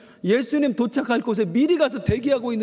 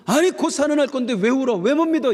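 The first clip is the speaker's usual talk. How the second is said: higher, louder rebuke; scolding; 7 semitones higher, 5 dB louder than usual. Pretend. Pastor mocks a Jesus who never scolded the mourners, with a high, loud rebuke. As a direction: snap at them impatiently. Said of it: "이러지 않으셨어요".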